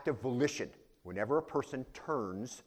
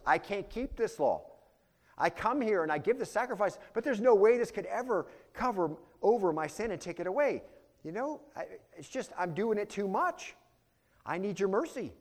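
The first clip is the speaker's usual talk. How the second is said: pleading sinner; 9 semitones higher than usual. Retold or imitated. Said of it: "The proper response in the Old Testament was".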